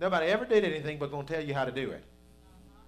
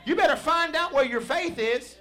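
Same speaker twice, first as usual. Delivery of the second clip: higher, louder, driving preacher's call; fiery preaching; 7 semitones higher, 6 dB louder than usual. Acted. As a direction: preach it hard to the congregation. Preaching at full force.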